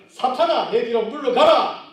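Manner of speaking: loud high cry of "사탄아"; sudden stern shout; shouted rebuke. Acted. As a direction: hurl the word at him. Jesus rebukes Peter.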